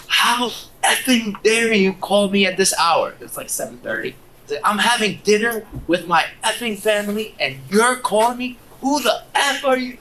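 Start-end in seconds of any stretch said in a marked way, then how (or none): 0.1-3.1 high-pitched indignant phone rant, drawn out; acted outrage. Owner berates a broker calling during dinner, acted outrage. Irate call recipient acted in story. 4.6-10.0 high-pitched, mounting indignation, imitating an angry owner; acted outrage. Owner scolds a cold caller over dinner, acted outrage.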